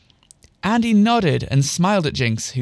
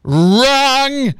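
loud, high, long-held bellow; 8 semitones higher, 5 dB louder than usual. A narrator's reading. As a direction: boom it out like a quiz host's buzzer. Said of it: "bellowed Andy".

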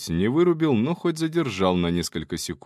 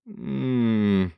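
pleased hum. Acted. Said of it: "я ж замычал от удовольствия".

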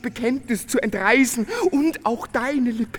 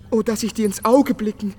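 passionate pleading; 4 dB louder than usual. Acted. Nolten's pleading confession.

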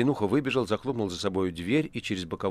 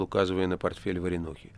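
plain reading voice

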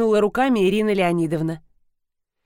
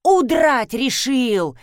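raised, slow indignant exclamation; alarmed cry; 4 semitones higher, 4 dB louder, much slower than usual. A narrator's reading. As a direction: cry out, short of a full shout. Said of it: "закричала Ирина Леонидовна". (возмущённый вскрик)

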